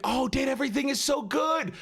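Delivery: high-pitched burst of fan enthusiasm; excited gushing. Real, not acted. Streamer gushes to a guest about Date Everything, excited. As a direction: squeal with delight over it.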